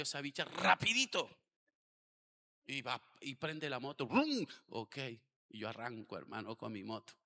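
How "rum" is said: high, loud imitated motor "rum"; engine noise. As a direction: blurt out the motorbike starting. (imitación del motor: "rum" alto y fuerte)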